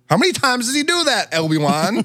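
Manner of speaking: high incredulous question; exasperated. Real, not acted. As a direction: disbelief at a stunt. Exasperated at the movie.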